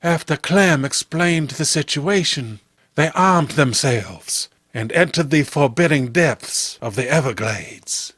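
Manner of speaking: ordinary delivery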